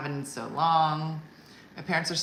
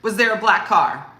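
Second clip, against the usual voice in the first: louder, fast suspicious mom; rapid grilling; 4 semitones higher, 10 dB louder than usual. Retold or imitated. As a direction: fire the questions like an interrogator. Mom grilling about car.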